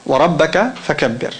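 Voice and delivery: fast flowing chanted Arabic; Quran recitation. Shown as a demonstration. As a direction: recite it as one smooth verse. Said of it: "When I recited the Arabic".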